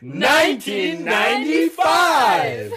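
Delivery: high, slow shouted price call; home shopping host. Pretend. Shouted infomercial price pitch.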